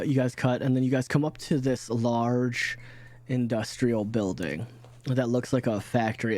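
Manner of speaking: ordinary talk, nothing marked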